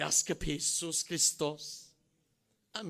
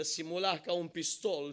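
usual speaking voice

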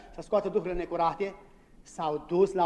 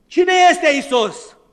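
high, loud, slow question; fiery preaching; 11 semitones higher, 10 dB louder, much slower than usual. Acted. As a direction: thunder it to the congregation. Preaching climax. (predică înflăcărată, întrebare rară și tare)

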